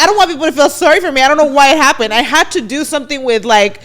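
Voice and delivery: loud and fast, pitched high; blurting it out, worked up; loud retold rant. To her listener, re-enacting the outburst, loud and high. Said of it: "I'm like".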